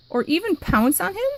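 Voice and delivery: usual speaking voice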